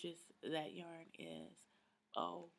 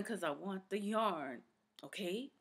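ordinary delivery

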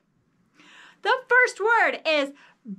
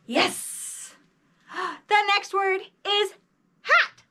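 usual speaking voice

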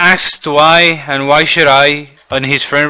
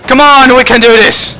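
urgent shout; 8 semitones higher, 4 dB louder than usual. Real, not acted. Gamer urging himself to score in overtime, urgent shout. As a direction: push through with a rallying cry.

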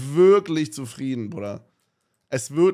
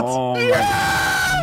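drawn-out, very high scream; shocked scream; much slower than usual. Real, not acted. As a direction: shriek it in disbelief. Huge blast hits castle.

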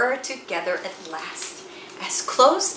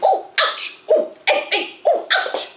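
yelping ouch; 3 dB louder than usual. A narrator's reading. Bitten by mosquitoes, yelping.

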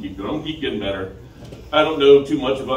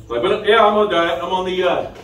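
louder, higher; 6 semitones higher, 6 dB louder than usual. Shown as a demonstration. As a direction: say it loud and proud. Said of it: "repeats the diet line louder".

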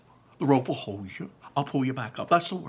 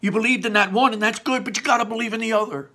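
higher, louder, urgent encouragement; shouting encouragement; 10 semitones higher, 6 dB louder, faster than usual. Acted. Barley shouting encouragement to Ian, higher and louder. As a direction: shout it to push him on. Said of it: "and then he shouted".

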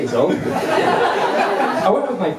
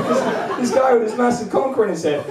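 ordinary talk, nothing marked

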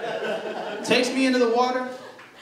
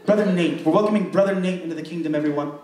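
a light, lower mock pastor voice, welcoming everyone; pastor addressing the congregation; 7 semitones lower, faster than usual. Pastor welcoming the congregation to a baptism, in a lightly put-on formal voice. Pastor announces the new member.

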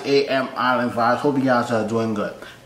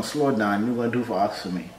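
soft, low mock-seductive murmur; flirty pleading; 3 semitones lower, 7 dB quieter than usual. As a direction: play at coaxing a lover, trailing softer. Mock-flirting with the woman on screen.

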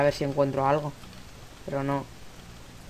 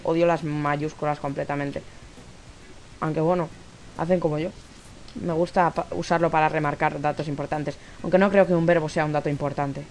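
ordinary talk

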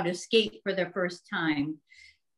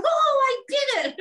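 excited; 6 dB louder than usual. Retold or imitated. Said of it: "and then they're".

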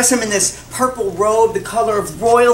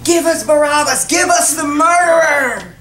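higher, forceful mob demand; crowd shout; 5 semitones higher, 4 dB louder than usual. Acted. The crowd answers Pilate, shouting their demand. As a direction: chant it like an angry mob.

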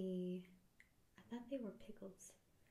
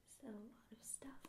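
whispering; 4 semitones higher, 10 dB quieter, faster than usual. Real, not acted. Whispered ASMR aside.

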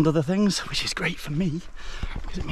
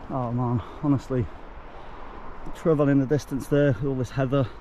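ordinary delivery

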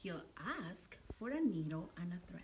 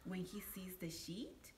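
plain reading voice

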